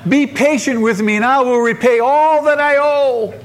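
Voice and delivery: high, hammed-up begging; desperate pleading. Acting a debtor begging on knees.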